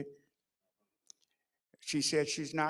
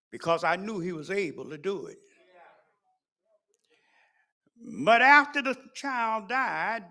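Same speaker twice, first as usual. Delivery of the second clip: loud, high preaching at full force; 5 semitones higher, 15 dB louder than usual. Acted. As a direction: preach it to the congregation, building. Preaching the David story.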